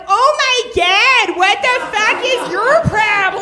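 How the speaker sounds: high, loud outraged local; mock drunk Chicagoan. Drunk Cubs fans squealing at comic, high and outraged. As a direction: squeal it like an offended drunk fan.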